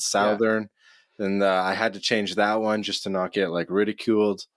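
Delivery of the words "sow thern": "Southern" with the "south" vowel; Canadian pronunciation. Shown as a demonstration. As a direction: start "Southern" like the word "south". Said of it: "not Southern".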